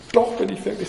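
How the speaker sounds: high, upset protest; near tears. A narrator's reading. Alice protests that she is real, near tears. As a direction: insist on it, mildly tearful. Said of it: "begann zu weinen".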